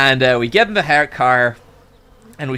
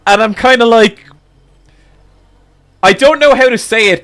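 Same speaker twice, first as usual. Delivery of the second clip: normal talking voice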